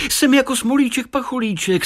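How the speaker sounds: put-on high, gleeful little boy; amused child. A narrator's reading. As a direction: pipe up high, amused at yourself. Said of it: "smál se Tadeáš". (pobavený vysoký dětský hlas)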